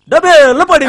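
storyteller bangs out the splash sound to startle; dramatic splash. Acted. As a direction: boom it out to startle the girl. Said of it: "దబీల్ మని భయపెడుతున్నావు?".

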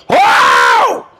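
deep, loud mock holler from the tape; imitated holler. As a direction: belt out a low preacher's "Oh!". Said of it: "I hear something go".

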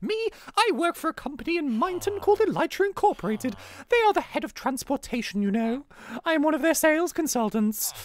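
high-pitched posh lady. Acted. Charlotte telling an old friend about her job, posh lady. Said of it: "she sounds like a posh lady".

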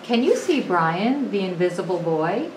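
usual speaking voice

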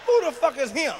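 ordinary talk

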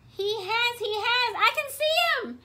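high, loud, urgent exclamation; excited alarm. A narrator's reading. Elephant spots someone in the pond, high excited shout. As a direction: cry out as if you've just spotted him.

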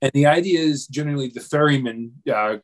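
ordinary talk, nothing marked

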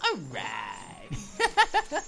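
laughing cheer of success; laughing relief. Real, not acted. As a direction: let out a laughing yes. Success after lifting lever.

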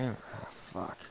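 whispered curse. Real, not acted. Muttered curse after loss.

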